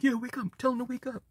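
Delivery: softly voiced little bee; bumblebee voice. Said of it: "I'm hearing the bumblebee right now".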